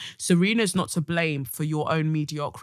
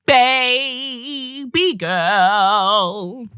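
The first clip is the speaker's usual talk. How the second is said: drawn-out echo; 7 semitones higher, 8 dB louder, much slower than usual. Real, not acted. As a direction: stretch it out lovingly and loud. Echoes the letter, drawn out.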